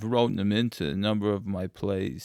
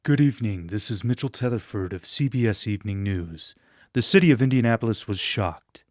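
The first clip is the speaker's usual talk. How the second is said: crisp, louder newscaster opening; news anchor read; 4 dB louder than usual. Shown as a demonstration. Asked to read the script as a TV news anchor. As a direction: announce it like the evening news anchor. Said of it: "read as if he is a news anchor".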